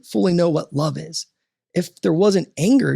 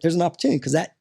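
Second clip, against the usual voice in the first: ordinary delivery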